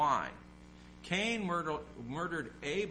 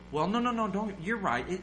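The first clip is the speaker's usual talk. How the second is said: caving in; 7 semitones higher, 4 dB louder than usual. Retold or imitated. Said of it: "what we tend to do is".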